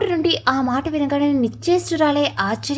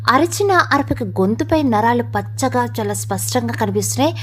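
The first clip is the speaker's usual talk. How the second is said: ordinary delivery, nothing marked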